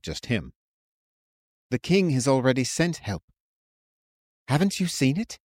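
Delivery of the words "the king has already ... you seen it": calm friend voice. A narrator's reading. Curian voiced, reassuring.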